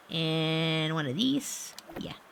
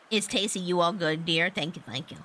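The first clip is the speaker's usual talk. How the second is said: ordinary talk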